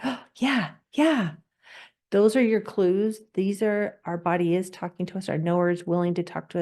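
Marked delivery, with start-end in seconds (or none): 0.0-1.3 gasped, high "oh, yeah"; acted burst of pleased excitement; happy realization. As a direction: voice a delighted yes, pitch swooping down. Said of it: "makes you just like".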